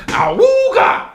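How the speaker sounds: very high, slow honking awooga; ahooga horn. Retold or imitated. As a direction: blare it like a vintage horn. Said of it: "Okay, then I got it".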